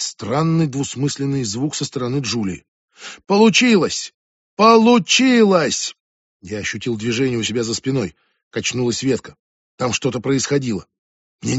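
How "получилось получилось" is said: high, loud panicked repetition, the second one slow; the panic spills over and drags out; near panic. Acted. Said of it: "В голосе Ребекки едва не паника".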